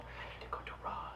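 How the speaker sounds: whispered aside; whispering. Real, not acted. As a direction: breathe it to yourself. Aside to himself.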